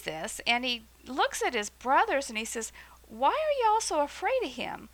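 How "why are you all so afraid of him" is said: put-on high, folksy Texas-accented question; Texas version. David asking his scared brothers, in a Texas accent. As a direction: ask it like a Texan cowboy. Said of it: "that's the Texas version".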